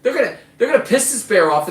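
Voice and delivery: worried about buddies angering the bear; alarmed. Retold or imitated. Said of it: "but I'm like".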